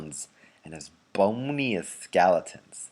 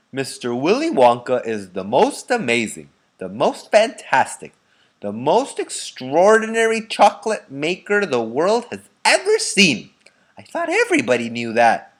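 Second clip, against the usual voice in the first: put-on loud, higher old man building up; an old man bragging about Wonka; excited elderly voice; Grandpa Joe enthusing; 5 semitones higher, 6 dB louder than usual. A narrator's reading.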